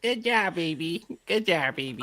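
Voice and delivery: high, lightly voiced granny praise. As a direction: coo it like a proud grandma. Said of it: "the granny voice".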